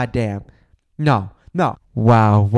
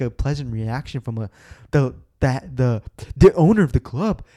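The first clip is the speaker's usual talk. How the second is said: ordinary talk, nothing marked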